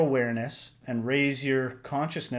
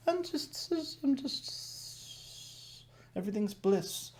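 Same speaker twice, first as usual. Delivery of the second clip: much higher, airy, dragged-out dreamy voice; blissed-out slow talk; 10 semitones higher, 9 dB quieter, much slower than usual. Pretend. Mocking enlightened bliss to a friend on the phone, slow and airy. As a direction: float the words like someone lost in bliss. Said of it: "call up a friend and talk really slow".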